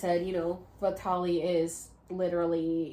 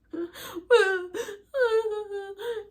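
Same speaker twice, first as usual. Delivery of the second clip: loud, high hammed-up sob; a quick fake sob into the bucket; mock sob laugh; 13 semitones higher, 7 dB louder than usual. Crying in her bucket, a loud high mock sob. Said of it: "crying in my bucket".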